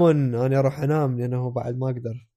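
ordinary talk

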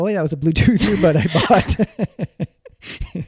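laughs through the last words, slowing down; a real chuckle breaking in mid-sentence; laughing. Real, not acted. Laughs confessing the earbud purchase.

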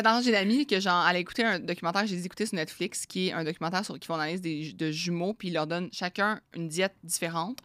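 normal talking voice

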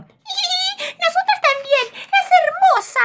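excited turkeys chiming in together; chirpy turkey hens. Acted. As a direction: gush like excited birds. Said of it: "las pavas agregaron".

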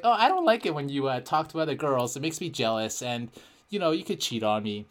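fake girl voice. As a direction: put on a sassy girlfriend voice. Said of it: "fake girl voice".

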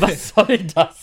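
slow, loud outraged question; can't believe what he hears; outraged disbelief. Real, not acted. Empört über Nutella im Kühlschrank. (empört ungläubig)

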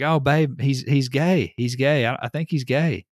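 imitating Australian guy. Australian tells fiance.

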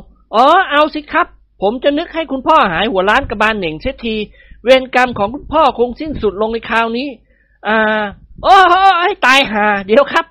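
high, playful voice of a joking son-in-law; cheeky young man. A narrator's reading. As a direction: tease him, then stop short. Nikorn teases his father-in-law. (ลูกเขยพูดกับพ่อตาแบบทะเล้น เสียงสูง)

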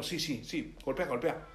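low, quiet tempter's aside; devil egging on. Acted. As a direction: slyly egg someone on as the devil. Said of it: "Y el diablo".

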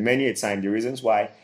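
ordinary talk

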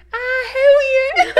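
drawn-out, loud, squealing greeting; extremely high voice. Retold or imitated. Dad greeting a surprise visitor, squealing sky-high. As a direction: let it leap into a delighted falsetto shriek. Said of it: "Like, it goes so high".

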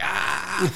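imitated reluctant yelp. Friend who doesn't want to touch the spider, squeamish yelp. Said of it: "doesn't want to, he's like".

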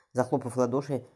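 plain reading voice

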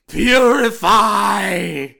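slow, drawn-out booming cry; booming shout. Acted. Plague doctor raising hands.

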